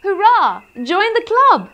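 high, loud cheer; shout. Acted. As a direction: cheer it out joyfully. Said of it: "the wildebeest shouted".

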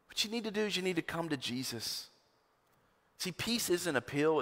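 ordinary talk

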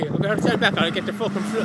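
ordinary talk, nothing marked

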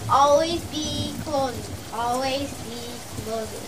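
hushed drilling. Acted. Sales boss drilling the closing motto into salesmen, hushed emphasis. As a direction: drop the voice and let each repeat fade.

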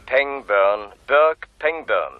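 each syllable stretched slowly for dictation; slow, deliberate name. Acted. Caller dictating a name to the detective, slow and deliberate. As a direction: say the name slowly so it can be written down. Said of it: "Schreiben Sie sich den Namen auf".

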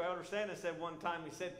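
ordinary talk

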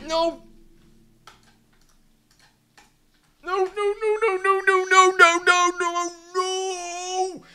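high, squealing string of nos; panicked no. Real, not acted. Gamer watching his character slip away, high panicked squeals. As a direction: shriek it as everything goes wrong.

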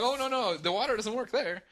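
high, hurried mock warning; imitating the host. Host stopping the guest from the bathroom, high mock alarm. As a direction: play the frantic host stopping him. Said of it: "He's like".

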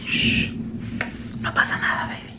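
hushed, comforting whisper; soothing whisper. Real, not acted. Comforting scared baby after the thunder, soothing whisper. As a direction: shush and whisper to calm a frightened little one.

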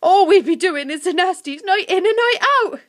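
high, loud goblin lament; worked-up creature. A narrator's reading. Creature agrees about nightly nasties, worked up.

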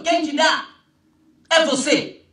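ordinary talk, nothing marked

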